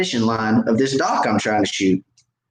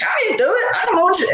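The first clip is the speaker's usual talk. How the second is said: very high squeaky denial; mock innocent protest; faster than usual. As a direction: protest your innocence in comic falsetto. Mock innocence to teasing witnesses.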